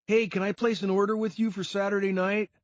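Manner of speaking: a casual buyer's ask; young man asking. A narrator's reading.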